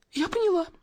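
high-pitched realisation, held back in volume; sudden cry. A narrator's reading. Actress suddenly understanding about the knife, crying out. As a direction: let it leap up high, a small cry. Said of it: "вдруг вскрикнула Волочковская".

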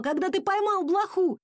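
high-pitched excited question; mild shout. A narrator's reading. Dad quizzes his son about catching a flea, mild shout. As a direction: call it out high, without much volume. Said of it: "кричал папа".

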